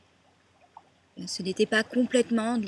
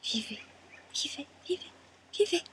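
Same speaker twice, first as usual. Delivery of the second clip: light birdlike calls, climbing higher; voices of the dead; 7 semitones higher, 4 dB quieter than usual. Acted. Woman voicing the dead chattering on the hill, birdlike. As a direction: echo it, each call higher, like chattering birds.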